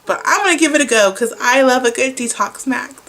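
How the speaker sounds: ordinary talk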